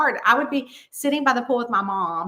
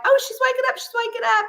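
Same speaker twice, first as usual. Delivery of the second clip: very high, squeaky-pitched excited onlookers; high-pitched mock excitement; excited relatives; 3 dB louder, much faster than usual. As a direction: mimic relatives exclaiming at a bedside. Voicing relatives at the bedside.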